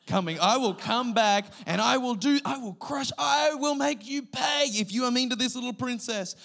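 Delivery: high, loud vow of revenge; hammed-up threat. Dad threatening anyone mean to his daughter, mock-furious. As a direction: protective dad losing it, mock fury.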